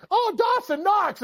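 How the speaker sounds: lightly hammed-up dreamy sigh from an ad; swooning commercial lady. Host mimics the heating ad, a slow high swoon. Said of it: "a heating one with".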